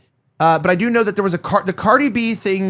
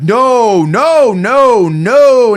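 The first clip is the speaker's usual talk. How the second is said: loud, high, drawn-out noes; emphatic denial; 6 semitones higher, 9 dB louder, much slower than usual. Acted. Live host condemning what someone said, loud mock-horrified denial. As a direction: protest each no louder than your normal talk. Acting out forced condemnation on air.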